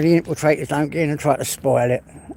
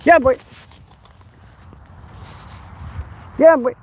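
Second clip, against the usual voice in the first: calling out to dog; 10 semitones higher than usual. Real, not acted. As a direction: holler to your dog.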